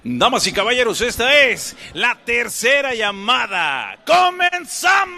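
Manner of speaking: booming, stretched ring announcer call. Acted. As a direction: announce it to the whole arena. Ring announcer opens the night.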